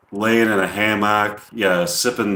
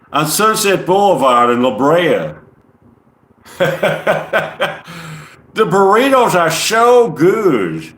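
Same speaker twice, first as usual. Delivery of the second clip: Southern gentleman; 6 semitones higher, 4 dB louder than usual. Acted. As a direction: put on a big Southern accent. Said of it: "It sounds like a Southern gentleman".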